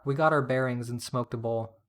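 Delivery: usual speaking voice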